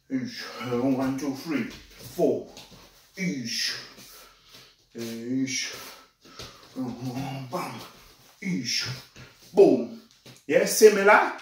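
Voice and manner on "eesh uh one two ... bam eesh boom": sharp breathy hisses with each strike; exertion grunts. Shown as a demonstration. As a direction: hiss out air on every strike.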